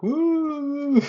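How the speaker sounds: very high, loud wordless hoot; a strangled, squealing hoot with no tongue; tongueless man's cry. Retold or imitated. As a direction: imitate a man with no tongue trying to talk. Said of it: "he'd be like".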